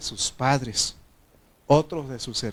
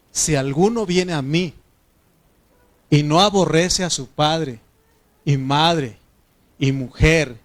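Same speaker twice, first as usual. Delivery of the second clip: preaching scripture; 3 semitones higher, 7 dB louder than usual. Acted. Pastor booms a Bible verse to the church, loud preaching. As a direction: proclaim the verse to the congregation, loud and lifted.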